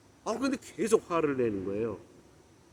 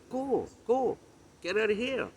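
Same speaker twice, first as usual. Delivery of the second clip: high-pitched mock send-off; playful "get lost" with lifted pitch; joking dismissal; 7 semitones higher, much slower than usual. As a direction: shoo them off as a joke. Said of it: "그건 이제 말을 농으로 그렇게 한 거죠".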